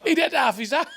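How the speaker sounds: shouted through laughter; a real laughing shout. Real, not acted. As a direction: burst it out laughing. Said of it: "laughing while telling".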